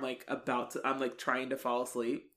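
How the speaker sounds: ordinary delivery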